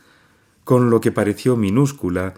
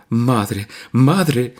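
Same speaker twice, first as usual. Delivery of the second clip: a daughter's voice, rising and louder, as if through tears; sobbing plea; 5 semitones higher, 3 dB louder than usual. A narrator's reading. Nadia sobbing to her mother, calling her. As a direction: call to her mother between sobs. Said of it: "profirió entre sollozos".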